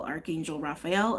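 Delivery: ordinary talk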